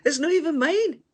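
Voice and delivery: high, loud falling protest. Real, not acted. Complaining to the viewers, high loud protest.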